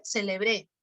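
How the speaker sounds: "celebré" hit on the last syllable; stress on BRÉ of celebré. Shown as a demonstration. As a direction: lean on final BRÉ in "celebré". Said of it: "el acento cumple función distintiva". (acento final en BRÉ de «celebré»)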